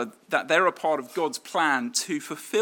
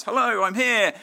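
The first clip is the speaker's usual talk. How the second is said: bright, higher call at the door; 6 semitones higher, 6 dB louder than usual. Retold or imitated. Mary arriving at Elizabeth's door, calling out. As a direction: call out a cheerful hello.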